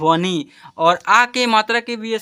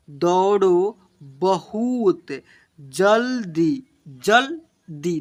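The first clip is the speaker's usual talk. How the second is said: slow, drawn-out syllables for pupils; slow reading aloud; much slower than usual. Shown as a demonstration. Teacher reading slowly to children, drawn-out. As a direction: read each word out slowly. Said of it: "यहाँ पर क्या लिखा हुआ बच्चों".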